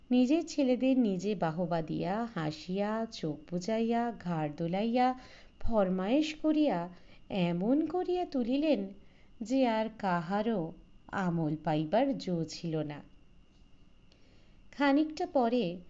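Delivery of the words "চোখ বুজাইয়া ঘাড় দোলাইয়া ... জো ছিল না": ordinary delivery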